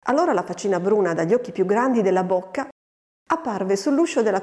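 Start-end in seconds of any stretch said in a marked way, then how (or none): none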